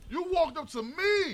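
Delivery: slow, high indignant voice; acted indignant man. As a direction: play the offended guy, drawn out. Acting out the man's side.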